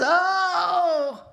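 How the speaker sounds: a retold plea, half sung and aching; desperate cry to God. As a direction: cry out to God from the end of your rope. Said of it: "all you can muster is".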